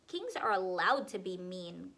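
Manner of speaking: low, loud haughty boast. A narrator's reading. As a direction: puff yourself up like a mean king. Connor answers with a boast.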